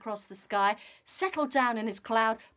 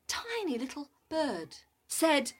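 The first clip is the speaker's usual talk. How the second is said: usual speaking voice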